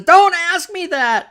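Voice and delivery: mock wail, very high and loud; drawn-out, high-pitched imitation of crying; retold tearful outburst. Shatner's story of a woman in tears, imitated loud tearful wail. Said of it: "she bursts into tears and says".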